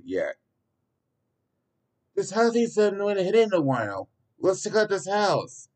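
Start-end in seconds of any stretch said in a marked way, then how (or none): 2.1-5.7 put-on high, excited kid voice; an eager kid pointing at a house; eager young boy. A narrator's reading. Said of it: "Zane eagerly said".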